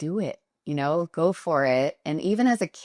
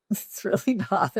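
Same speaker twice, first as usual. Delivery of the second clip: laughing denial, higher pitch; 5 semitones higher than usual. Real, not acted. Founder laughing off the glamour myth, higher. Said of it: "repeats denial laughing".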